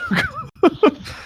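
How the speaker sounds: high burst of laughter. Real, not acted. Friend laughing at her own joke, a high laugh.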